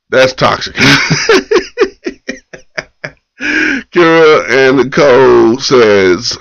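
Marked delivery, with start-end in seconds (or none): 0.8-2.5 a burst of high laughter. Real, not acted. Host laughing at own toxic joke, high laugh. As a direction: crack up high.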